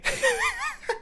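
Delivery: a sudden high, soft cackle of disbelief; helpless giggle; high burst of laughter. Real, not acted. Laughing at the text.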